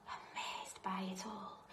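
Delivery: awed whisper; a snail amazed, whispered. A narrator's reading. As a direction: breathe it out in wonder. Whispered wonder.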